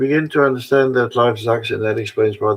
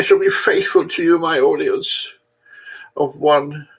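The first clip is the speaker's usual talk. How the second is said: high-pitched mock pledge of loyalty; mock solemn vow; 3 semitones higher than usual. Vlogger apologizing to a tiny audience, mock solemn vow. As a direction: swear it theatrically, pitched high.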